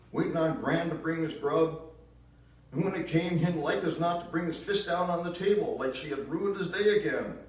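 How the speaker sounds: put-on high, loud bitter accusation in a southern accent; bitter southern mother. Ma blaming Gramps for Gran's death, loud southern bitterness. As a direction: spit the blame out in a shrill Southern voice. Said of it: "that nasty tune of hers".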